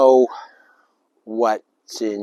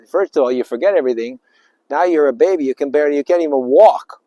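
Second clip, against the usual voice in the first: ordinary delivery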